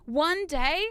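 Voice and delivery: ordinary delivery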